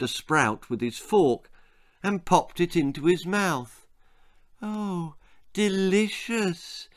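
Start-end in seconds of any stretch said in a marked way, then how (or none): 4.6-6.9 mumbling while chewing. A narrator's reading. Bill chewing a sprout at the table, mumbling. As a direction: mumble it slowly in a boy's voice. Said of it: "he mumbled as he chewed it slowly".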